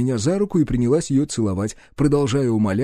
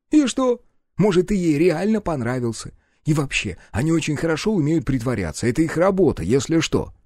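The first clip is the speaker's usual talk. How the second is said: high, animated dismissive partner; brash sidekick; 6 semitones higher than usual. Acted. Partner doubts his friend's theory, dismissive. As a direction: brush the suspicion off, high and lively.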